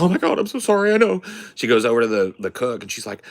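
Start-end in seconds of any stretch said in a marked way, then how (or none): 0.0-1.2 high mock-weepy apology; whining and crying. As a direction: apologize as a frazzled waitress near tears. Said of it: "she's whining and crying to every customer".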